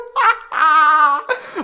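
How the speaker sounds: high rising laugh of disbelief; giddy laughter; delighted laugh. Real, not acted. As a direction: laugh out of sheer joy.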